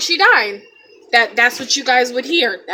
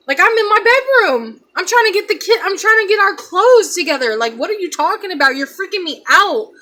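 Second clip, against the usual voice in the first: high, loud exasperated protest; retold panic; 8 semitones higher, 5 dB louder than usual. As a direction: argue back in rising alarm, acted out. Retold panicked argument with mother.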